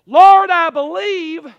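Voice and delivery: loud, high, drawn-out plea; loud cry of faith. Acted. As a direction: cry out slowly to the Lord. Preacher cries out the prayer.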